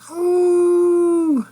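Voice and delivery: a sudden, very high, very loud "Hoo!" howl; werewolf howl. A narrator's reading. Werewolf Carl howling at the full moon, high and loud. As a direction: let out a quick wolf howl at the moon. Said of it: "he starts with a quick".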